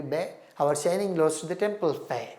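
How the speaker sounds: ordinary talk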